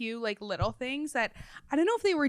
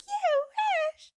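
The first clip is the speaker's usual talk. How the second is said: squeaky-high, louder mimicry; high and animated; 6 dB louder than usual. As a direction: imitate her way up high, animated and silly. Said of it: "high and animated".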